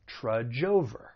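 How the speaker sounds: "trudge over" joined smoothly, trud-jover; linked words. Shown as a demonstration. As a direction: carry the J sound right into "over". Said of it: "linking may occur".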